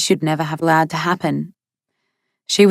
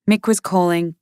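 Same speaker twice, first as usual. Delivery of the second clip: ordinary delivery, nothing marked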